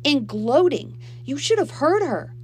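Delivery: high, loud tattling; heated protest. A narrator's reading. Girl telling Mom on her stepsister, heatedly. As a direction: protest loudly and indignantly.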